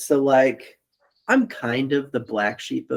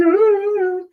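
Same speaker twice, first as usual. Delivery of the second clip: high mock wail of a crying mom; a hammed-up high sobbing "Ah"; imitated wailing sob; 5 dB louder than usual. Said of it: "It's just like".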